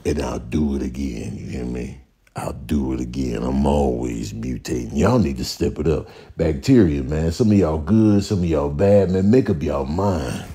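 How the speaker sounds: low, menacing germ boss; a cool, threatening virus, pitched far down; deep virus voice. Acted. Virus brags and scolds.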